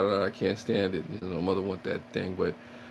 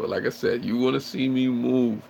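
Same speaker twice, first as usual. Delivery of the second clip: ordinary talk, nothing marked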